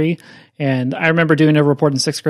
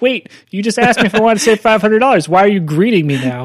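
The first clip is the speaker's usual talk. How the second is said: baffled; 6 semitones higher, 4 dB louder than usual. Retold or imitated.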